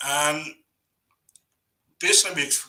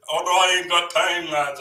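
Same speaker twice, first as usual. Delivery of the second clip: louder, hammed-up Irish-tinged brush-off; Jedi brushing off; 5 semitones higher, 7 dB louder than usual. Jedi refusing to explain Irish history, hammed-up louder brush-off. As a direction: toss it off like a lad at the pub. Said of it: "And Jedi's like".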